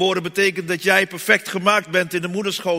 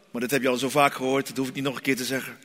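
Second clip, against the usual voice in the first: ordinary delivery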